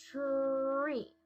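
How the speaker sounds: TREE pulled out slowly like a rubber band; stretched like rubber band. Shown as a demonstration. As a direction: stretch the r in TREE, then glide into ee. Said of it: "do it with the rubber band".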